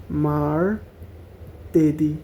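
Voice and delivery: drawn out syllable by syllable; মার্তেদি said slowly, each syllable apart. Shown as a demonstration. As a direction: break মার্তেদি into slow syllables, mar-te-di. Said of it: "syllable ভাঙা ভাঙা পড়লে তাহলে এটা সহজ হবে".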